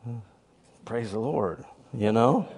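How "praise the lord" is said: reluctant reply. Retold or imitated. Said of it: "And she's like".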